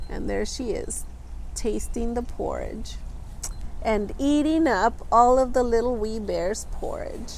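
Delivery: usual speaking voice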